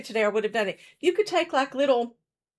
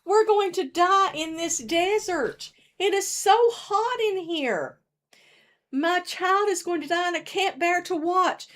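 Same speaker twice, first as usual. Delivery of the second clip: slightly higher, slowed, despairing mother; a lightly voiced Hagar fearing for her child; 6 semitones higher than usual. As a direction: let the mother's despair show gently. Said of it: "Hagar thinks".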